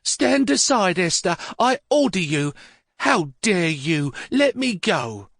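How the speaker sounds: put-on high, indignant old lady forcing her way past; imperious old mother. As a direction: order her aside like a furious matriarch. Old mother orders daughter-in-law aside.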